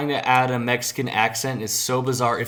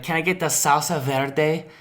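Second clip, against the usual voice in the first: slightly higher put-on Spanish accent on SALSA VERDE; 4 semitones higher than usual. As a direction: order with an overdone Spanish accent. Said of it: "I'm not saying it ironically".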